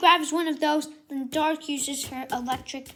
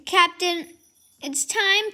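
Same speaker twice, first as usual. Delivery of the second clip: heroic call; 7 semitones higher, 6 dB louder, slower than usual. Acted. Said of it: "Captain Bolt's like".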